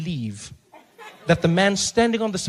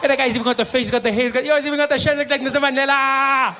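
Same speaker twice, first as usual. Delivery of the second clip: higher and louder, building excitement; excited; 6 semitones higher, 3 dB louder than usual. Retold or imitated. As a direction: get more and more amazed. Excited driver shouting.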